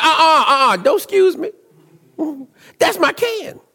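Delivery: wag a finger with your voice; sassy mock retort. Pastor retelling his retort to the lady in the store, high mock sass.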